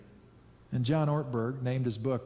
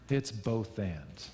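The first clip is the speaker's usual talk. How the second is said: ordinary delivery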